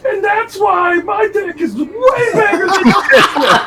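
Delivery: put-on high, shouted drunken boast half crying with laughter; drunk bragging yell. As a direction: yell it like a wasted teen showing off. Drunk kid yelling in background.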